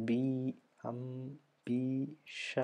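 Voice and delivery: അം, ബി, ഷ each sounded alone with pauses; isolated drawn-out beats, "ബി" with long ee; syllable by syllable. Shown as a demonstration. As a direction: break ambition into am... bee... sha. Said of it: "three or more syllable sounds".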